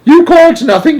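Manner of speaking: very high, loud retort; outraged cry. A narrator's reading. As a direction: cry out in disbelief. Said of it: "cried poor Missus Bucket".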